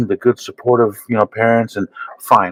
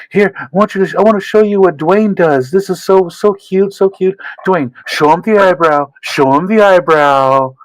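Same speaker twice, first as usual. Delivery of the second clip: high-pitched, gushing show-off parent; imitated proud parent; 9 semitones higher than usual. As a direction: coo and coax your kid to perform for guests. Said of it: "his mom and pops".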